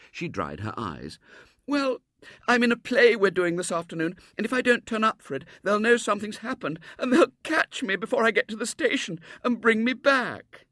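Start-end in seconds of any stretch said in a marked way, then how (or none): 1.7-10.7 put-on high, loud child voice; fretful little girl. A narrator's reading. Girl explaining to William why she's stuck, high fretful child. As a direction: pour out the problem in a high girlish voice.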